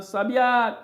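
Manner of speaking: "sabiá" with a high, ringing final á; stress on last syllable. Shown as a demonstration. Teacher repeating an oxytone for students, "sabiá" stressed on final á. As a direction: throw the weight onto the á of "sabiá". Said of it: "oxítona".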